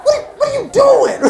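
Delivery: put-on shocked at what the kids are doing; disbelief. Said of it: "You're like".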